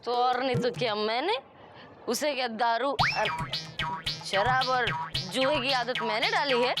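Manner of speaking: nagging wife. Acted.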